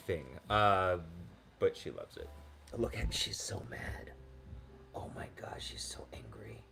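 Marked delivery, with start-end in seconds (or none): 2.8-6.6 soft whispered aside about the dog; amused whisper. Real, not acted. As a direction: whisper so the dog won't hear.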